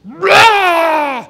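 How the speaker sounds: loud, high mock roar into the phone; hammed-up rage roar; angry roar. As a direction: blow up with a wordless roar. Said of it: "picking up the phone and".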